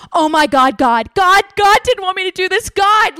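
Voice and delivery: high, rising overwrought cries to God; hammed-up distraught excuse; mock anguish. Cheater blaming God to a guy, mock distraught wailing.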